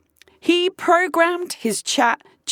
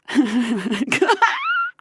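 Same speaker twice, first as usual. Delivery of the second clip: incredulous laugh; 2 semitones higher than usual. Real, not acted. Laughing at AI's reply.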